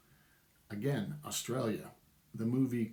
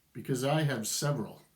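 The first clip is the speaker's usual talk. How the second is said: normal talking voice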